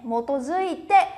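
motozuite said slowly, syllable by syllable; slow syllable reading. Shown as a demonstration. Teacher slowly repeating motozuite for students. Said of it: "này".

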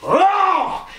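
loud high snarling roar; angry dog roar. Retold or imitated. Storyteller imitating his bulldog attacking the dummy, angry roar. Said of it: "he would get angry and come at the dummy like".

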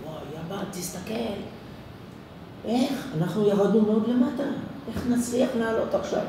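a hint of a worried little boy; child's amazed voice. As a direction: play the boy staring up from the wadi. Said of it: "הבן מסתכל".

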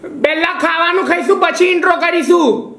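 high holler in Gujarati; sustained, high-pitched calling; shouting. Real, not acted. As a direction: yell the answer to mum across the house. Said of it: "इतना चिल्ला रहे हैं".

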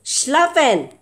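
loud stern orders to the pet; loud mock command. Owner loudly ordering her cat to stay put.

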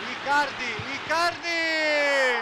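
slow, stretched scorer's name; drawn-out name call. Real, not acted. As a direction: hold the name long in triumph. Goal scorer's name called out.

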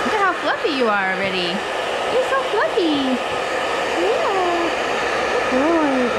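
high, slow cooing to the dog; sweet pet voice. Real, not acted. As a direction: coo over a fluffy puppy. Groomer baby-talks to dog.

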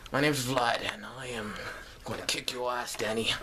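a mock threat in a hint of a Vlad accent. Doing his Vlad accent for the fans, a mock threat. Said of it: "Let me do my Vlad accent".

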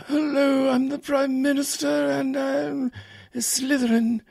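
high strained mock Thatcher; Thatcher as Voldemort. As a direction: play Thatcher as a Slytherin villain. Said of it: "almost like, uh, Voldemort".

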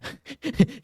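sudden high-pitched burst of laughter. Real, not acted. Gamer startled by the screen, high-pitched burst of laughter. Shocked by something in game.

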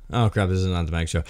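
ordinary delivery

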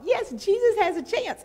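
high, loud burst of joy; excited. Retold or imitated. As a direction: shout it out with sudden relief. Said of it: "I was so excited".

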